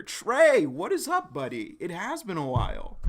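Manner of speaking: high, excited shout-out to a buddy; thrilled high-pitched hello; excited greeting. Real, not acted. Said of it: "I am excited".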